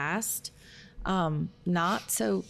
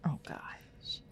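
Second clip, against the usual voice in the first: whispered; 12 dB quieter than usual. Real, not acted. Whispered reactions to question.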